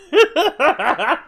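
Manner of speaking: short high loud laugh; burst of laughter. Real, not acted. Streamer laughing at chat over a quick win, high and loud. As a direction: crack up with glee. Laughs after getting the item.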